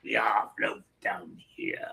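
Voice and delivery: slow, soft whispered clown menace; scary movie clown voice. Acted. Quoting the clown from the movie, eerie slow whisper. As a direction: whisper it slowly like a creepy clown. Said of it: "I love the way he said that".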